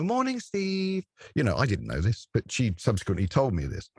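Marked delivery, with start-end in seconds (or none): 0.0-1.0 high, slow sing-song greeting; girl waving. Retold or imitated. Girl waving from her bedroom window, high sing-song greeting. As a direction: call it across the valley, high and slow. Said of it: "wave to me and go".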